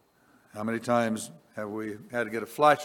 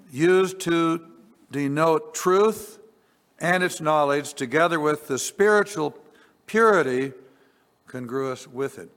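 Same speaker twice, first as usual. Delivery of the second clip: higher, louder, deliberate reading of definitions; emphatic reading; 5 semitones higher, 6 dB louder than usual. Acted. Preacher reading Thayer's definitions aloud, emphatic and raised. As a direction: press each word of the definition home.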